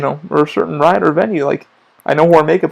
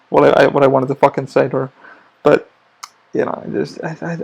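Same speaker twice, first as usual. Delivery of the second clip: ordinary delivery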